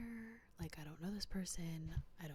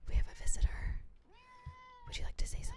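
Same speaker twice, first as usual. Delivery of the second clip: much higher, sing-song pet talk; cooing to a pet; 10 dB louder, faster than usual. Real, not acted. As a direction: coo up high at the cat. Greeting the cat Miso.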